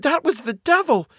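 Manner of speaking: very high, horrified wife's voice; terrified wife breaking awful news. A narrator's reading. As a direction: pitch it high with sustained alarm. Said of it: "said the woman, terrified".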